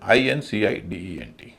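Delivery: ordinary delivery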